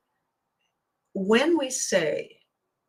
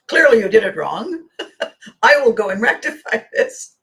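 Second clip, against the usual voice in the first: mock bravado; 7 dB louder than usual. Friend's confident boast, retold.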